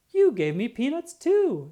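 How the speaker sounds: high, loud delighted squeal of joy; loud, high happy squirrel shout; thrilled exclamation. Acted. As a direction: burst out with gift-opening glee. Thrilled opening gift.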